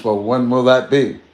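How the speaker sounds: mild acted movie dialogue; movie quote. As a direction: ask like the Road House character. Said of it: "Like that movie Roadhouse".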